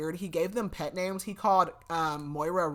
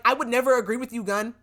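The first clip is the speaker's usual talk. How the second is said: higher, louder mock indignation; indignant retold retort; 6 semitones higher, 7 dB louder than usual. She answers Gunn's claim they agreed, indignant. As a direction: throw it back, acting offended.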